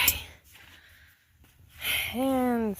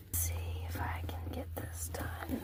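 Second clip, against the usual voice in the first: whispered to himself under his breath; whispering; 7 semitones higher, 12 dB quieter than usual. Real, not acted. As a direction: breathe it out, voiceless and private. Muttering to himself while working.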